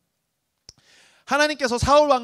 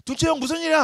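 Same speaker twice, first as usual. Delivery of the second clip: eager little brother; 9 semitones higher, 3 dB louder, faster than usual. Retold or imitated. Youngest asking second brother.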